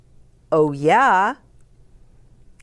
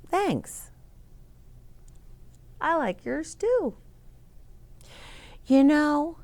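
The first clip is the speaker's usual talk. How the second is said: put-on higher, small girl's voice; Nellie's voice; 8 semitones higher, 5 dB quieter than usual. A narrator's reading. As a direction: voice Nellie as a shy little girl, pitched up. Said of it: "Nellie said".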